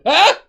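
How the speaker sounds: very high, loud startled-angry scream; mock scream. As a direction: scream it out, hammed-up. Imitating people who yell back.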